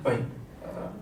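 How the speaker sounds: normal talking voice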